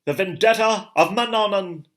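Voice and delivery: high, loud, strange-voiced proclamation; a seer crying out a prophecy, not murmured; seer's incantation. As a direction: intone it like a prophet to heaven.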